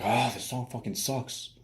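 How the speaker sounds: slow, drawn-out annoyed groan of a complaint; bitchy complaint. Acted. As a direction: act out a fed-up girlfriend. Acting out bitchy girlfriend's complaint.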